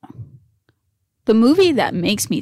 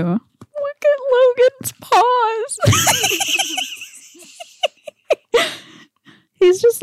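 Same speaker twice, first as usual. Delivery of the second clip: cooing at dog; slower than usual. Real, not acted.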